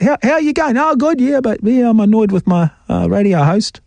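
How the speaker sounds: high, whiny mock voice of a grumbling listener; mock conversation. Host mimics annoyed listener Bob griping to a friend, high whiny voice.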